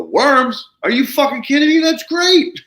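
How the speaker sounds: high, loud, overjoyed exclamation; retold reaction played big and loud; thrilled disbelief. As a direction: burst out in delighted astonishment. Retold delighted reaction to worms.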